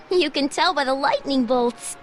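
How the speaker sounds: usual speaking voice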